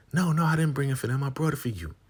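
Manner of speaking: very high, sugary put-on voice; sweet helper. Woman bringing food to Marva, very high and sweet. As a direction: offer kindly. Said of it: "She's like".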